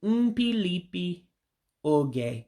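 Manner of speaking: "Mpilipi", pause, "oge", very slowly; real slow. Shown as a demonstration. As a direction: stretch "Mpilipi oge" out for learners. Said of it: "we'll say it real slow".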